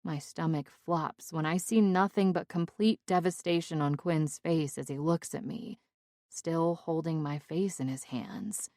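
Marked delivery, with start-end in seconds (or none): none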